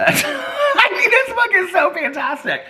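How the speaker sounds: high, laughing through the words. Real, not acted. Praising the book to viewers, laughing hard. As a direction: gush while still cracking up.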